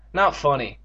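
ordinary delivery

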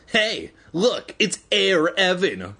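high-pitched mocking greeting; joking jeer. A narrator's reading. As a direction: tease him like a jock. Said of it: "Conan joked".

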